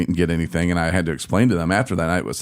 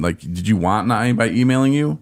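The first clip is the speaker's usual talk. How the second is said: ordinary talk, nothing marked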